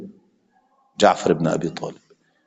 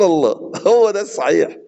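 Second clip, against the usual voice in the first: mock fawning commenter; 8 semitones higher than usual. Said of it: "قال".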